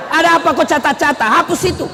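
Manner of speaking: fast, pushy demand; storming at the clerk; angry husband. Acted. Suami marah di PLN. (suami marah-marah di kantor PLN)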